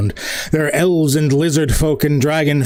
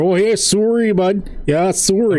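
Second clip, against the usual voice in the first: exaggerated Canadian accent, higher on "Oh, yeah"; Canadian sorry; 4 semitones higher than usual. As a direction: apologise like a polite Canadian, rounded "soory". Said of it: "a Canadian sorry off later".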